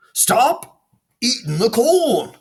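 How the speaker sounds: high, loud and slow command; shout. Acted. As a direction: shout at the crow. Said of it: "Jerome shouted".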